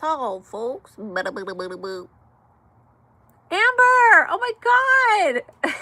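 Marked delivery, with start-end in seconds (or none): 3.5-5.7 excited call. Real, not acted. Spots a friend joining chat.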